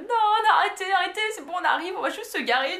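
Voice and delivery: high, urgent, half-laughing panic; panicked plea. Retold or imitated. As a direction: beg the police to stop, fast and anxious. Panicked inner plea to police.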